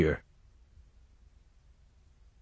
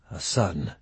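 softer, strained and held back; 3 semitones lower, 7 dB quieter than usual. Acted. Corby to the colonel about his lost son, soft and strained. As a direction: force the words out quietly through pain. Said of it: "in a voice both soft and strained".

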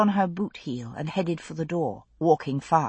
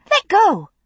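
high, loud cry to break free; a startled woman's loud, high protest; let go cry; 14 semitones higher, 5 dB louder than usual. A narrator's reading. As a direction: yank free and yell it high. Woman jerking free from his grip.

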